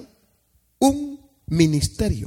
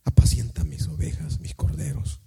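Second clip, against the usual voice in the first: soft whisper; 8 semitones lower, 6 dB quieter than usual. Acted. Jesus gives Peter his charge.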